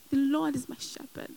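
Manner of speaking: soft, murmured "The Lord is my shepherd"; quiet affirmation. Pretend. Believer alone in frustration, softly affirming "The Lord is my shepherd". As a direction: say "The Lord is my shepherd" almost under the breath. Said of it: "I know it's frustrating".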